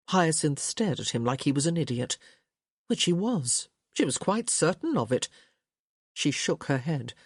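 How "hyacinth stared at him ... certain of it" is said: ordinary delivery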